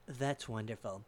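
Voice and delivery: unimpressed reply. Retold or imitated. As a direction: answer his bragging with understated indifference. Unimpressed reply to boastful man.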